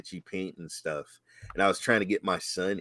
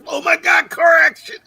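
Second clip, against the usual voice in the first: high, loud burst of excitement; excited outburst; 10 dB louder than usual. Real, not acted. Host reminiscing about RC cars, thrilled shout.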